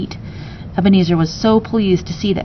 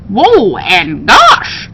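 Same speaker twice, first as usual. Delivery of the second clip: slow, drawn-out whoa of wonder; amazed exclamation; 4 dB louder, much slower than usual. Acted. Parrot staring up at the grand house, amazed. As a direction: gasp it out in awe.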